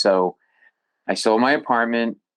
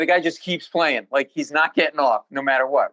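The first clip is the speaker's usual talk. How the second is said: usual speaking voice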